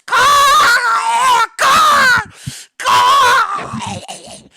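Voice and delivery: dying bird screeching caw caw caw; screaming caw. Acted. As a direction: shriek like a bird being eaten alive. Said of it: "As it screams".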